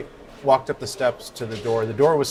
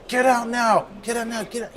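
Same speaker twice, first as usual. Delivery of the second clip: high-pitched mock yell, fading in volume; imitated angry homeowner; 11 semitones higher than usual. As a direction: act out ordering an intruder out. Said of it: "he starts swearing at me".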